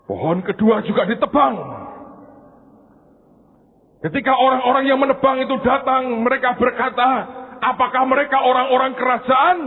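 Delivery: high, loud pulpit storytelling; preaching at full force. Acted. Preacher telling of the second tree, loud and high. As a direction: drive the story hard at the listeners.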